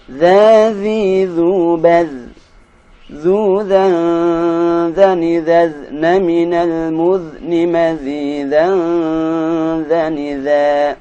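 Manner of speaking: loud, slow syllable drill; teacher modelling za syllables; slow recitation drill. Shown as a demonstration. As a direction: chant each syllable clearly and slowly. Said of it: "drill syllables slowly".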